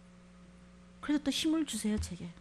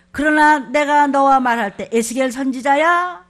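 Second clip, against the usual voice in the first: loud, slow divine call; commanding voice of God; 3 semitones higher, 11 dB louder, slower than usual. Acted. God calling the prophet Ezekiel, loud and slow.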